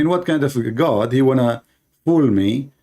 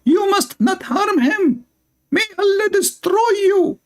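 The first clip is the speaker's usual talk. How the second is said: squeaky mock heavenly wife scolding the earthly wife; mocking huri voice. Huri scolding the wife who harms her husband, in a high mock voice. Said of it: "she will say".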